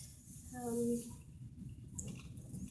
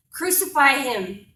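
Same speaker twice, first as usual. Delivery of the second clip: raised, higher shout; crowd's scream; 5 semitones higher, 12 dB louder than usual. Acted. Mob calling for Christ's death in a poem, shouting. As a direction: call it out as a mob's demand. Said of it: "they're screaming in my face".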